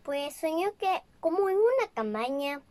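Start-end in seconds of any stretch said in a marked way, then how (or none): none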